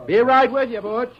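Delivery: called out loud across the yard; loud call back to a partner. Acted. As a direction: shout it to someone a way off. Guard calls out to Butch.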